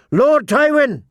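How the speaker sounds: sharp, high urgent call; urgent shout. A narrator's reading. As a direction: shout it out in alarm. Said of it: "An urgent shout of".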